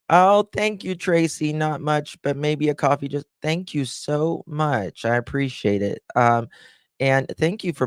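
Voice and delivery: high-pitched, grateful gush; touched and excited, voice lifted high; delighted thanks. Real, not acted. Thanking viewer for a gift.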